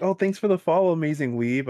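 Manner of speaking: high, loud, cheery thanks; mock-cheerful follower greeting; chirpy fan. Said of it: "They're like".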